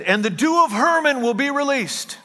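high, ringing declaration; prophetic proclamation. Retold or imitated. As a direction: proclaim it high, drawing out the end. Quotes Paul's prophetic declaration.

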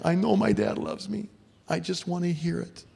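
put-on high, yearning girl's voice; imitated daughter, pitched well up; pleading daughter. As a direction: plead like a daughter aching for reassurance. Daughters longing to hear dad's love.

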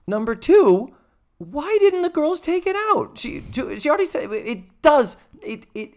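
very high incredulous rant. Real, not acted. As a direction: squeak up in disbelief. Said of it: "own rant about bad writing".

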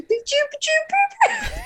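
high playful gunshot noises; finger gun pews. Pretend. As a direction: pew pew like a kid shooting. Finger guns at the cop.

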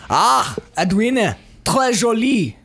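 French compliment in a slow, put-on Scottish accent. Complimenting a necklace, French in Scottish accent. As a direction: attempt French with a Scottish burr, drawn out. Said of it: "do it in a Scottish accent".

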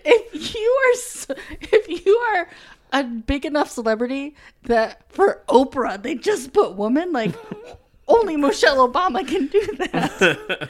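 high, loud and laughing incredulity; amused disbelief. Retold or imitated. Viewer reacts to Oprah's subtitle, laughing in amazement. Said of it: "And I was like".

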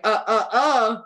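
mock flustered mom stopping the kids; stammering mom. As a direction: stutter then forbid it. Mom blocking kids retold.

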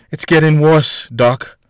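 crackling, hurting plea to the doctor. A narrator's reading. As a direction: let the voice crack with pain. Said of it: "in a crackling tone".

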